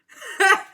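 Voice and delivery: high loud laugh bursting out; sudden high cackle of delight; loud burst of laughter. Real, not acted. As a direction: crack up at the scene. Laughing at the scene.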